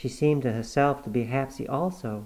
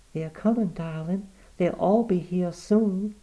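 soothing daughter; 8 semitones higher than usual. Acted. Cornelia soothing her mother at the bedside, tender. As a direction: hush her gently.

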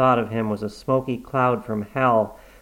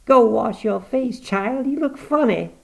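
put-on higher, louder peevish old mother; cranky old woman; 12 semitones higher, 4 dB louder than usual. Granny complaining about her face being washed, peevish. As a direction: grumble like a stubborn invalid.

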